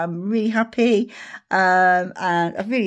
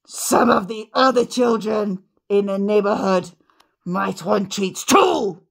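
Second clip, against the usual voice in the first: put-on slow, firm scolding of a greedy child; a hint of a strict teacher; stern telling-off; slower than usual. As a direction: lay down the law slowly to the girl. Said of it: "I went".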